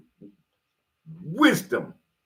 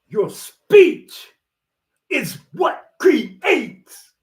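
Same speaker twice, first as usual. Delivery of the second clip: loud, slow, fired-up preaching with a catch in the voice; hyped up; 7 semitones higher, 5 dB louder, much slower than usual. Real, not acted. Preaching to viewers that speech creates, fired up and slowed right down. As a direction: drag out each word as the fervour builds. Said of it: "I'm getting too hyped".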